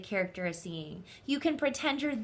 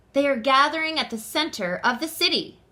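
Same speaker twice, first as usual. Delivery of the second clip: conductor voice; 4 semitones higher, 6 dB louder than usual. Acted. Conductor tells the children.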